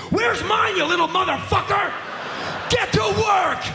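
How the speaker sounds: high, loud, furious yelling at the guy; mock angry shout. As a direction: scream it like a fed-up girlfriend. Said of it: "she's not angry going".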